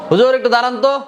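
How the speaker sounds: high, loud call across a distance; calling out from afar. Shown as a demonstration. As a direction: yell after the teacher walking away. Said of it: "এইভাবে না।". (দূর থেকে জোরে ডাক)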